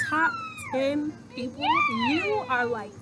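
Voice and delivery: high, teasing emphasis. Real, not acted. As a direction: tease her with a playful high voice. Teasing ranking.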